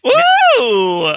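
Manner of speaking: loud high whoop; big delighted woo; excited whoop. Real, not acted. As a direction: cheer it out. Cheering a juicy backstory.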